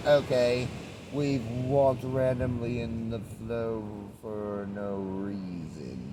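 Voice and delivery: slow, dragged-out weary quote; drawn-out mock resignation. Quoting themselves after aimless walking, slow and weary. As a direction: stretch it out like you've given up.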